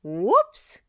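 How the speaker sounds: loud, goofy whoops as he falls; surprised yelp. A narrator's reading. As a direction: yelp while tumbling down. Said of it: "Goofy cried".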